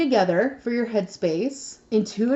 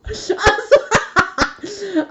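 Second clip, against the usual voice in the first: burst of laughter; 12 semitones higher, 6 dB louder than usual. Real, not acted.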